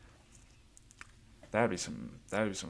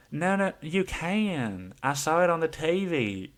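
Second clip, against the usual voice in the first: much higher, very slow insistent voice; hammed-up squeaky certainty, drawn out slowly; insistent Lydia; 8 semitones higher, 4 dB louder than usual. Lydia insisting to her friend she saw it on TV, very high and slow. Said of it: "she goes".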